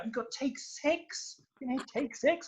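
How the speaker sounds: high, gushing and thrilled; genuinely excited. Real, not acted. Telling the host about the festival lineup, thrilled and gushing. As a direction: burst out with real fan excitement, voice up high. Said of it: "I'm so excited".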